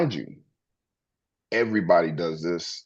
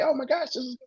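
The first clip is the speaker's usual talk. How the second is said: much higher, gushing squeal of a super happy person; mimicking gushing; 4 dB quieter, much faster than usual. Imitating a super happy friend met on the street, high gushing squeal. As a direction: squeal it like someone bursting with joy.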